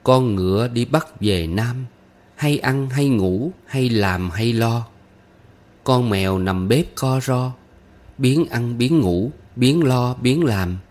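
slow, lifted folk-verse recitation; teaching a rhyme. A narrator's reading. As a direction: recite in measured phrases, drawing out the line ends. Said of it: "Tôi dạy nó bài".

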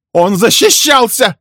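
very high, loud, drawn-out scream; enraged woman's shriek; furious shout. A narrator's reading. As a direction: scream in fierce defence. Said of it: "яростно крикнула Милли".

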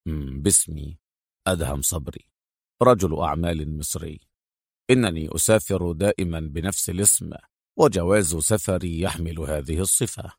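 low, soft masculine voice; deep, reserved officer; composed male agent. Acted. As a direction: state your cover in a deep, even voice. Voices Adham answering.

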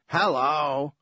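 lightly voiced raspy older cousin; a hint of rasp calling hello; lightly rasped greeting from the door; raspy Howard. A narrator's reading. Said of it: "Cousin Howard's raspy".

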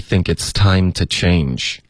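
slow, connected teaching read; slow model reading. Shown as a demonstration. Teacher reads the line a second time, moderately slowly. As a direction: read it slowly but flowing, without stretching every word. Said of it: "老师再来读两遍".

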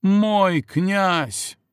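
high drawn-out moan; passionate moan. A narrator's reading. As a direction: cry out in pleasure. Heroine moaning in passion.

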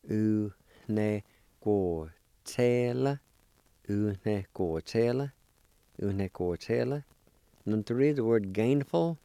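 usual speaking voice

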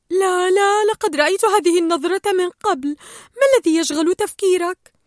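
high, loud voice of concern; wary female fish. A narrator's reading. Peach spots Gill's familiar look, high insistent alarm. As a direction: protest up high, then question him firmly. Peach challenges brooding Gill.

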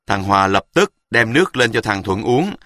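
plain reading voice

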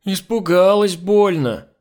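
slow, high, loud whine; whining. A narrator's reading. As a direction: whine it out like a scared maid. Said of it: "ныла Нюша". (протяжно, плаксиво)

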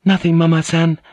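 high, innocent courtesan voice; high-pitched young woman. A narrator's reading. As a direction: answer in a high girlish voice. Voices Kiku answering.

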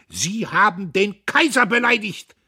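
high, loud military accusation; utterly dashing shout. A narrator's reading. Dietrich points at the offender, sharply accusing shout. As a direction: cry it with cutting dash. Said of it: "rief er mit äußerster Schneidigkeit".

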